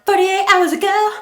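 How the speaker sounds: very loud, high-pitched playful yell; silly high shout. Pretend. Interrupting own story to fix timeline, silly shout. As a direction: blurt it out in a piercing silly voice. Playfully blurting the corrected time.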